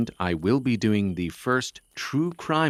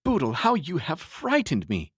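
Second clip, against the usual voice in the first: choked voice; 11 semitones higher, 4 dB louder than usual. Acted. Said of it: "with a choked voice".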